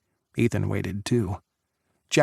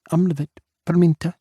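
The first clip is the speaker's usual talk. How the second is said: Czech phrase; 6 semitones higher, 4 dB louder, faster than usual. Acted. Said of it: "She spoke again in Czech".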